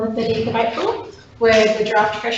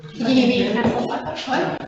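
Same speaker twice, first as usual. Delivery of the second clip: normal talking voice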